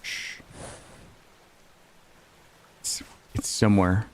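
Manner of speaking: hushed whisper. Real, not acted. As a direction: whisper while stalking. Stalking prey quietly.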